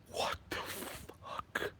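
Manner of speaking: slow, stunned whisper. Retold or imitated. A shocked kid mutters at the meeting, slow whisper. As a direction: breathe it out in disbelief, unvoiced.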